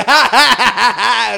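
high, loud staged cackle; loud mock laugh. Showing how loudly he laughed in the theater, cackling. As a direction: act out a big theater laugh. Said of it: "I laughed out loud".

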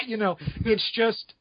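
normal talking voice

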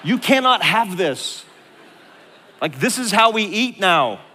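hammed-up desperate plea, loud and high; mock desperation. Comically defending the last toaster.

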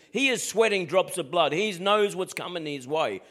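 ordinary talk

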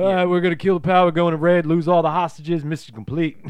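high, loud mock action-movie agents; gung-ho FBI agents. FBI agents announcing their raid plan, loud mock bravado. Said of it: "they're all like".

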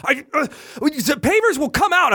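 high-pitched mock sputtering; flustered. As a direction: imitate a flustered man blurting an objection. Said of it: "he's getting really flustered".

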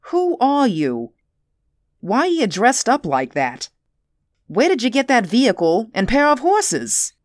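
sharp, rising demands; interrogating. A narrator's reading. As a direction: grill him with questions. Official interrogates Cole.

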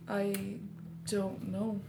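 soft, halting mock bewilderment; quietly lost for an answer; stumped hesitation. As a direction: leave long gaps, as if drawing a blank. Retelling being stumped by a question.

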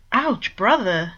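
slow, hammed-up wounded cry; theatrically wounded, half joking; mock hurt. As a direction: play the mock-offended brother. Bord clutched his chest.

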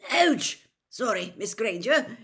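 high yelp, then quick apology; a loud startled ouch easing into apology. A narrator's reading. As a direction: cry out, then say sorry. Professor slips, grabs student, apologises.

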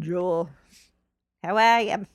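badly faked Australian accent; playful, shaky stab at Australian; attempted Australian accent. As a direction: have a clumsy go at an Australian accent. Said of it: "If I could do a good Australian".

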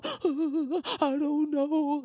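very slow, high, trembling "I don't know"; mock a frightened character; scared wide-eyed. Mocking a scared movie character, slow and high. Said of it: "all wide-eyed and all".

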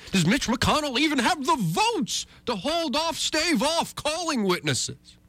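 very high, hyped-up questioning; mock suspense. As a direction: send the question soaring in mock drama. Mocking the breathless Senate drama.

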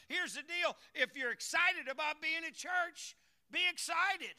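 high, loud preaching burst; rally the church to get excited; full-voiced revival preaching; fiery preaching.

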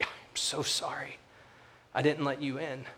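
soft, whispered, teary apology; hushed and near tears, ashamed; tearful apology. Retold or imitated. Retold neighbour apologising in tears.

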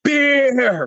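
high, drawn-out bellow for beer; mock scream. Giant waking in the back seat to his driver, mock scream. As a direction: roar it out, imitating a demanding giant. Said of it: "He screams".

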